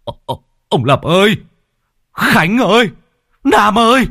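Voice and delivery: high, loud panicked shriek; shrieking call. Acted. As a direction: scream their names in terror. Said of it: "ông rít lên". (rít lên hoảng loạn)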